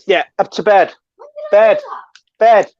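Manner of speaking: loud call up to bed. Acted. Sending the kid to bed.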